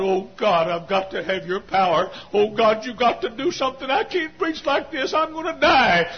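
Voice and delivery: desperate prayer climbing high; plead, pitch rising to a strained peak; crying and begging. Retold or imitated. Preacher alone in the woods at night, begging God. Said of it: "I'd cry and beg God".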